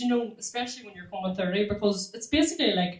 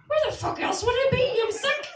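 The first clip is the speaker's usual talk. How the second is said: exasperated outburst. Retold or imitated. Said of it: "And you think".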